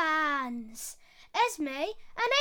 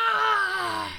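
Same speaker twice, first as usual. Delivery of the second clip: loud pained lion roar; lion stung on the nose, roaring; 6 dB louder than usual. Acted.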